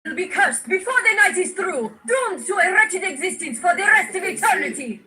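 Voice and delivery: high, eerie fortune-teller voice; ominous prophecy. Acted. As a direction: intone it like a possessed seer. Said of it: "A vision has struck me".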